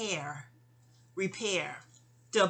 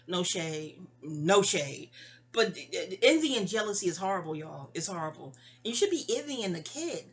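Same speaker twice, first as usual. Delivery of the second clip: normal talking voice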